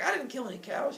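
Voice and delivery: high, defensive protest; acted squabble; mock yelling. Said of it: "they start yelling back and forth".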